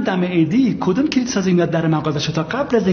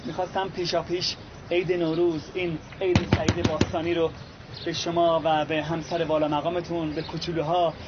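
formal greeting; 6 dB quieter than usual. Acted. Formal New Year greeting speech.

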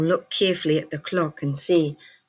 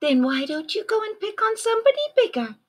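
very high fast sweet voice; friendly ladybug voice; faster than usual. A narrator's reading. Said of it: "friendly ladybug's quick retort".

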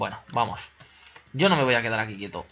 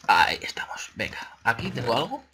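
whispering. Real, not acted. Muttering to himself while looting.

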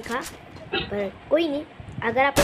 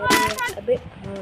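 startled outburst; 13 semitones higher, 3 dB louder than usual. Real, not acted. Button pressed too long, annoyed.

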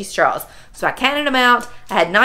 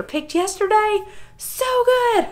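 delighted gush; 12 semitones higher than usual. Real, not acted. Raving about fresh tomatoes.

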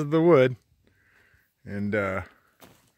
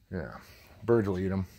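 usual speaking voice

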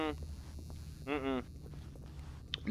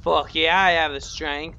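higher, louder, fired-up yell; pumped up; 6 semitones higher, 9 dB louder, slower than usual. Real, not acted. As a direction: hype yourself up, loud and proud. Answers own question emphatically.

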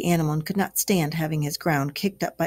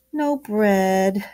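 groaning; 2 semitones higher, 3 dB louder than usual. Acted. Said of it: "groaned the mole".

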